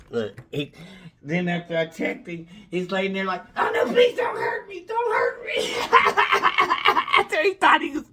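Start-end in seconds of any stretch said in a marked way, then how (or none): 3.6-5.7 high, whimpering mock plea; hammed-up high cry for mercy; mock pleading. Mocking beaten guy's pleading. 5.8-7.1 breathy high cackle; mocking laugh burst. Real, not acted. Laughing at the beaten guy.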